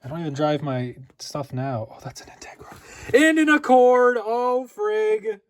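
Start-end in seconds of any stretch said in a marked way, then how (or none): 3.1-5.4 higher, louder, slow drawn-out; real excitement; excited. Real, not acted. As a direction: gush over the car. Spots an Accord.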